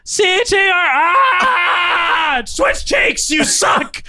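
high strained yells while pinched; shouting in pain. Real, not acted. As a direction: cry out the letters in pain. Cheeks pinched during spelling.

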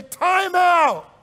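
high, loud call of time out; shouted time out. Pretend. Preacher acting out a spouse mid-argument, shouting time out. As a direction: call a halt like a referee. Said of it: "if you pause and say".

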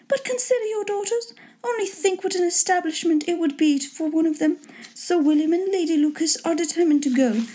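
high-pitched lady urging the husband; put-on high, insistent wife voice; pleading wife. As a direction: pitch it way up and press the point. Wife presses husband to visit.

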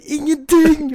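very high, loud shriek; desperate wail; wailed nothing. Retold or imitated. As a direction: shriek it in despair. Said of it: "Hun bare".

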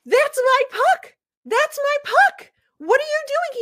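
mock yell. Fan yelling at the man who gave away her puck, indignant shriek. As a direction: shriek it like someone just stole your prize. Retold clash over a puck.

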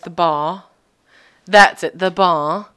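"bar" as "bah" with no r; mock Northern English, loud on That's it; Northern English accent. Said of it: "her R was non-existent".

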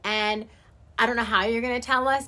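usual speaking voice

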